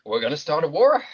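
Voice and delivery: high goofy Boston ape voice; Boston accent. Retold or imitated. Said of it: "an ape with a heavy Boston accent".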